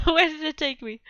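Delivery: loud, through a laugh; laughing at the game's surprise. Real, not acted. Streamer lost in the game, repeating the question through laughter.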